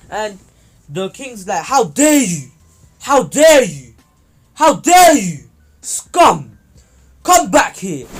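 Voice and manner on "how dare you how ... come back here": roaring How dare you over and over, much louder and higher; a king screaming in rage at the hero; furious yelling king. Acted. King bellows at Naruto in fury.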